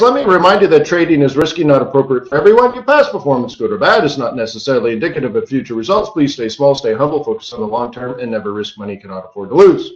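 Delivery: brisk, rapid-fire risk warning; rattled-off disclaimer. Shown as a demonstration. Host racing through the risk warning for viewers, rattled off. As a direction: reel off the legal warning quickly. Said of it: "Did I say that too fast?".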